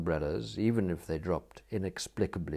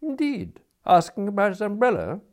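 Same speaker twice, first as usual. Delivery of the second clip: high, loud echoing question; surprised query; 13 semitones higher, 6 dB louder than usual. A narrator's reading. Smith echoes the attendant's report, surprised and questioning. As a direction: echo it back with lifted, mock-polite surprise.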